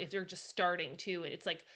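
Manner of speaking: ordinary talk, nothing marked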